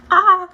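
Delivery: loud yelp as hair rips; pained yelp. Real, not acted. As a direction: cry out in sharp pain. Peeling mask rips hair.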